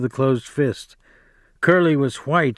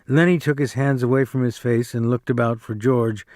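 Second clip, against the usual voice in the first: ordinary delivery, nothing marked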